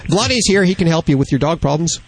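ordinary delivery

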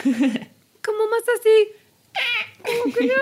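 high, tight and pinched; tense strained voice. Shown as a demonstration. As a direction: squeeze the throat like you're nervous. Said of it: "la voz sale muy distinta".

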